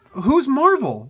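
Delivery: high, loud, incredulous outburst; exasperated disbelief. Real, not acted. Reader baffled by the interviewer's name, exasperated disbelief. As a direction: throw the question out loud and high.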